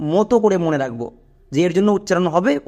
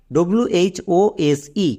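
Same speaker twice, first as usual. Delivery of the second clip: slow spelling out; much slower than usual. Shown as a demonstration. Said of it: "একটা example দেখো".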